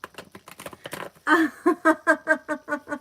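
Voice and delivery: long, high, loud burst of laughter; hearty laugh. Real, not acted. Streamer reacting to a viewer's comment, hearty laughing. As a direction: burst into a real, helpless laugh.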